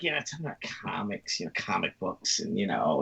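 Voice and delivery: very low, soft mumbling cartoonist; Robert Crumb. Host imitating his son's cartoonist impression, low and muted. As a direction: sink into a deep, subdued, offhand drone. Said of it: "do a great Robert Crumb impression".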